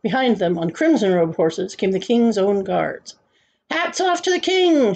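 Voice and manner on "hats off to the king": high-pitched captain's call; shouted order. A narrator's reading. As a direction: call it out high to a whole crowd. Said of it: "shouted the captain of the king's own guards".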